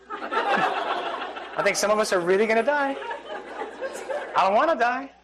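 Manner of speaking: laughing through the words; amused, laughing aloud. Real, not acted. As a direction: chuckle while joking about dying. Jokes about dying, laughing.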